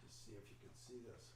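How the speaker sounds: distant, far from the microphone; speaking away from the mic. Real, not acted. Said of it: "Mark, you're off mic".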